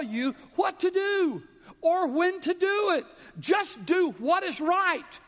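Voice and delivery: fervent sermon push; preaching. Acted. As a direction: drive it home from the pulpit. Preaching exhortation.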